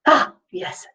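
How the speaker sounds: usual speaking voice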